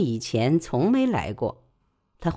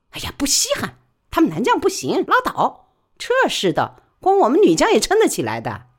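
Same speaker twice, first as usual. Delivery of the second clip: higher, louder, quick working woman; brisk high-pitched working woman pleading her case; Li Baoli's voice; 9 semitones higher, 3 dB louder than usual. Acted. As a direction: press her case fast and eager. Li Baoli answers.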